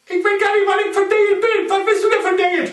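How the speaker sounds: high squeaky snake question. Acted.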